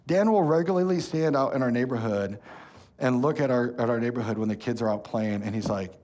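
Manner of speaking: usual speaking voice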